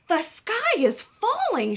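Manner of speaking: high, slow alarmed cry; panic. Acted. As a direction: Chicken Little sure of doom. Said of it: "she thought".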